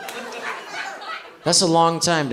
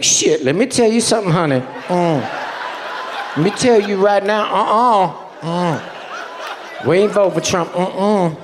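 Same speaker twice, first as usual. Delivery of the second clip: higher, hammed-up sassy auntie voice; mock sassy voice; 5 semitones higher than usual. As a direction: play Kamala talking down to a crowd. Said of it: "she's like".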